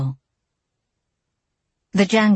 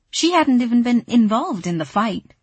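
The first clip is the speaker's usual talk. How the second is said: ordinary delivery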